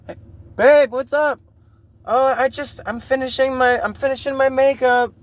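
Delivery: put-on girlfriend stalling. Girlfriend answering from the bathroom.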